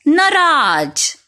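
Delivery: नाराज drawn out slowly as pupils mispronounce it; mock the mistake: नाराज with ज sound, slow; common wrong form. Shown as a demonstration. Teacher imitates the class's wrong नाराज, slow. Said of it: "यह अशुद्ध शब्द है".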